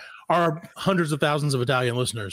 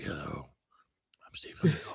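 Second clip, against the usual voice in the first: low, soft sinister whisper; 11 semitones lower, 9 dB quieter than usual. Retold or imitated. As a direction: whisper it like a menacing tough guy. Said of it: "He, like, whispers".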